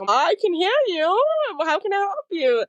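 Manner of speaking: high-pitched, hammed-up helpfulness; exaggerated mock reply. Acting out an awkward encounter.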